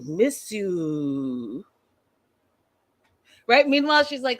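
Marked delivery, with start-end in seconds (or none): 0.0-1.6 low, slow eerie voice; low creepy drawn-out miss you; voodoo whisper. Retold or imitated. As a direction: croon it like a spell. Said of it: "Like voodoo".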